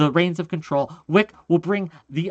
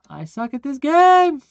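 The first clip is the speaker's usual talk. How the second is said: higher, louder frustrated exclaim; frustrated outburst; 8 semitones higher, 4 dB louder than usual. Real, not acted. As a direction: shout it at yourself in frustration.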